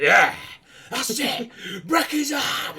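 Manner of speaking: high, loud, rowdy cheering; frenzied ringside yelling; screaming supporter. Acted. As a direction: egg the fighter on at full scream. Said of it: "he's, like, screaming at you".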